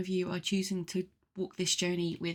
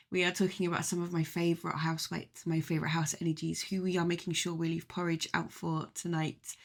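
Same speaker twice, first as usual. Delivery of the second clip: usual speaking voice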